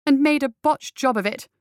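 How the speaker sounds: sharp comeback; retort. Acted. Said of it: "she retorted".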